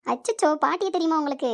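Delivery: put-on delighted baby talk; doting grandmother. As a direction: fuss sweetly over a toddler. Grandmother baby-talks to the child.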